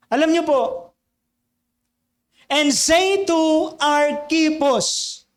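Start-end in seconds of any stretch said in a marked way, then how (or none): 2.5-5.2 And say to Archippus drawn out, say leaned on; slow emphatic reading. Shown as a demonstration. Pastor repeating the verse to the congregation, slow and weighty. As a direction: read the verse slowly, stressing say.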